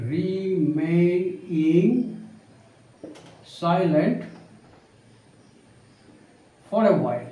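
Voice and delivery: each word drawn out slowly with pauses; slow dictation. Shown as a demonstration. Teacher dictating to students, slow and spaced out. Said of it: "আমরা এরকমভাবে বলতে পারি".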